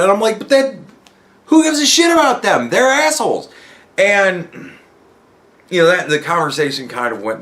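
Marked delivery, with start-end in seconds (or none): 0.4-3.5 exasperated repeat. Retold or imitated. Pressing his point to a friend, high exasperated. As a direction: insist again, impatient.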